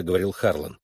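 plain reading voice

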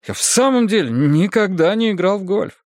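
high-pitched, mildly sheepish insistence; self-deprecating. Acted. Husband admits to detectives he's no golfer, self-deprecating. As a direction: admit a shortcoming to win them over. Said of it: "постарался это высказать самоукоряюще".

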